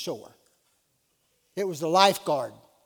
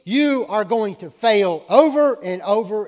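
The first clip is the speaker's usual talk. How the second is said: loud, slow, higher-pitched warning; forceful preaching; 5 semitones higher, 12 dB louder than usual. Real, not acted. Preacher warning the church about failing, forceful preaching. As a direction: hammer it home to the congregation.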